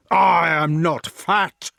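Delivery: loud, slow offended giant; shouted. A narrator's reading. Giant shouting at the taunting prisoners, slow and loud. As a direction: bellow back indignantly. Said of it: "shouted Galapas".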